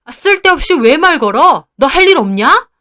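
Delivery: loud, high, furious snapping; angry father-in-law. A narrator's reading. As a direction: lash out at a daughter-in-law for bothering you. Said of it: "저한테 화를 내시는 거예요". (버럭 화내는 시아버지)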